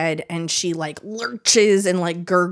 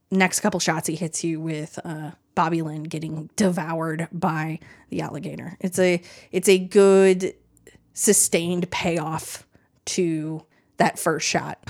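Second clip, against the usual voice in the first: usual speaking voice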